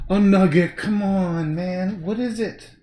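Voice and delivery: loud, fed-up plea to the dog; exasperated at dog. Real, not acted. Dog interrupting the painting.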